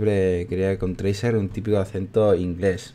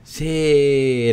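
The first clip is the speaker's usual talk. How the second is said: long, high, loud cheer; triumphant yes; 6 semitones higher, 5 dB louder than usual. Real, not acted. Gamer celebrating a play, triumphant drawn-out cheer.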